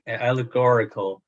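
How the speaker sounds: ordinary delivery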